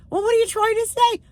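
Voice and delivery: high, puzzled mock husband voice. Husband baffled by wife's demand, lightly mocked and high. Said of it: "He just looked at me like".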